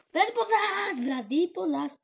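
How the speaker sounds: loud, high call to a dog. Real, not acted. Owner calling puppy to play, loud high call.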